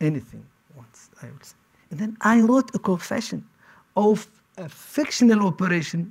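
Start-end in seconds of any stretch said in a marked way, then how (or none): 0.1-1.6 much softer, lower, dying away; whispered-down surrender; resigned fading repeat. Retold or imitated. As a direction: let the words drain out defeated.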